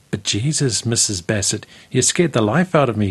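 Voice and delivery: ordinary delivery, nothing marked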